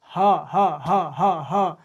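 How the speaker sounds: ह repeated alone, straight from the throat; throat sound demo. Shown as a demonstration. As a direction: send each separate ह directly from the throat. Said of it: "direct आपको कंठ आ रहा है".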